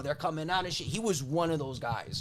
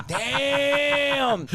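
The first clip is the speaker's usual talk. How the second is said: amazed; 8 semitones higher, 4 dB louder than usual. Acted. Reacting to old-school pig slaughter.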